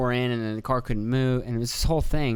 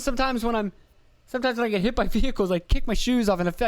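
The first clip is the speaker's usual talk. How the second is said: Dane Cook bit; 10 semitones higher, faster than usual. Retold or imitated. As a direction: ham it up like a stand-up routine. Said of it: "Sounds like a Dane Cook joke".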